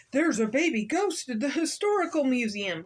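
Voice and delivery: loud report building to a high demand; agitated caller. A narrator's reading. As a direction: call it in urgently as the cross man. Irate man phoning police in story.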